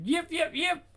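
very high mock yaps; mimicking the dog. [name] imitates the Shih Tzu yapping. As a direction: yap like the little dog.

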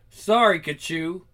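usual speaking voice

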